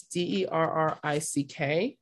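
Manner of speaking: letter by letter, D E R R I C K; spelling it out. Shown as a demonstration. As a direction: say each letter of D E R R I C K. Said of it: "his first name is spelled".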